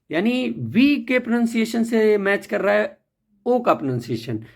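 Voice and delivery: ordinary talk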